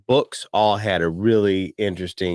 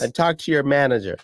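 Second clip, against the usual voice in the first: entitled customer; 6 semitones higher, faster than usual. Retold or imitated. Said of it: "wants to talk to the manager".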